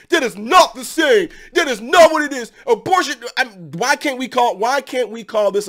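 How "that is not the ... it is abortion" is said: louder, higher mock outrage; mock indignant viewer. Vlogger imitating upset viewers, mock indignant shout. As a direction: huff it like an offended commenter. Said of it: "some of y'all gonna get ticked off".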